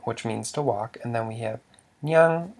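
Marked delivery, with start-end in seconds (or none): 2.0-2.5 nyang said high. Shown as a demonstration. Teacher lists the similar words, nyang high for still. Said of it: "which means still".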